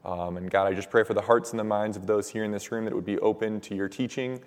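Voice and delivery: ordinary talk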